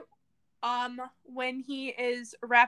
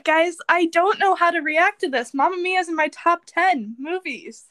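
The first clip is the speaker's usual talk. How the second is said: outraged exclaim; 9 dB louder than usual. Real, not acted. Outraged friend tells the listeners.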